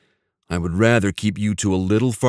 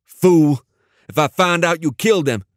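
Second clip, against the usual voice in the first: furious shout, higher and louder; rising anger; 9 semitones higher, 4 dB louder than usual. A narrator's reading. Dante yelling over the phone about his boys, furious. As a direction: yell it at the stranger who took his boys. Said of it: "Dante's voice rose in anger".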